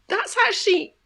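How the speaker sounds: high-pitched, halting objection; retold protest, voice lifted high; incredulous retort. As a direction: pitch it up in polite disbelief. Retold objection to nursery staff.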